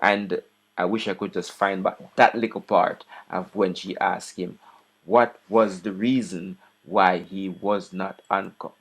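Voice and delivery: ordinary talk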